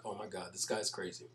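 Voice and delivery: quiet, soft mock exasperation. A man shaking his head at the ignorant guy, soft disbelieving aside. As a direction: let it out under your breath, shaking your head. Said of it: "He's shaking his head like".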